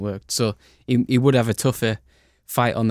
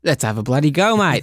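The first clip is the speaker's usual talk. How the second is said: louder, hammed-up Australian accent; 4 semitones higher, 5 dB louder than usual. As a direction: go full Aussie on it, badly. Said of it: "awful Australian accent".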